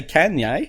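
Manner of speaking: "[name]" with the a of "can"; flat short a in "[name]", Can-yay; Can-yay mispronunciation. Shown as a demonstration. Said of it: "you don't say [name]".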